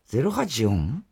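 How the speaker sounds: usual speaking voice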